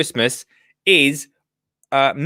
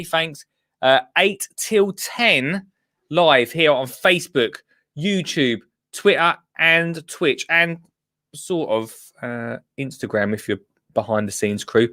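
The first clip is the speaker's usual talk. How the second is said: ordinary talk, nothing marked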